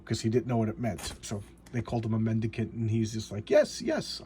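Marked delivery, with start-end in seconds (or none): 3.5-4.2 high eager. Retold or imitated. Character misunderstanding insult.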